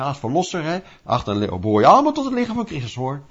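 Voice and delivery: usual speaking voice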